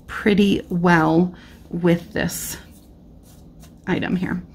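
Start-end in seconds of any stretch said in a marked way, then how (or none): none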